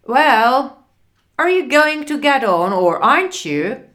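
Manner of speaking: loud, brisk demand; impatient. A narrator's reading. Bus driver challenges Frank at the stop, loudly impatient. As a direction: hurry a dithering passenger along sharply. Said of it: "He looked very impatient".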